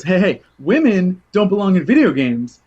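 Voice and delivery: loud, jokey provocation; host baiting the guest with a joke; mock sexist joke. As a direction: deliver a bad joke with bravado. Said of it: "he goes".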